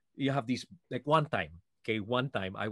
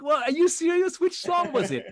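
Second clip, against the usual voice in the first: much higher and louder, amazed; surprised disbelief; 8 dB louder than usual. Retold or imitated. Singer to bodyguard, stunned by his praise, high and amazed. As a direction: squeal in disbelief at the compliment.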